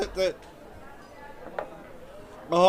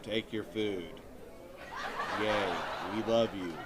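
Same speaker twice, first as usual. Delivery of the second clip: much lower, softer mock-sweet mom voice; 10 semitones lower, 8 dB quieter than usual. Mother handing him burnt meat, low mocking sweet voice. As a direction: fake affection, dripping sarcasm. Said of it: "threw it on a plate and was like".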